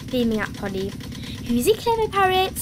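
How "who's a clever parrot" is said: high, doting praise to a pet; Granny Pig. A narrator's reading. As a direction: coo it to the parrot. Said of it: "Granny Pig said".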